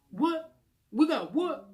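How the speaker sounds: high, loud double take of disbelief; shocked disbelief. Retold or imitated. Stepdad hearing about the pregnancy, shocked and loud. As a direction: hit the news like it can't be true.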